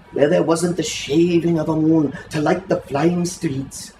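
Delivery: usual speaking voice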